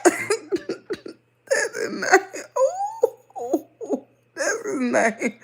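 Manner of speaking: laughing hard with high hoots; real laughter, squealing. Real, not acted. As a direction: burst out laughing as the name comes. Laughs on remembering the name.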